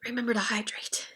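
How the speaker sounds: whispered aside. Real, not acted. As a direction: breathe it as a quick aside. Aside reminder to viewers.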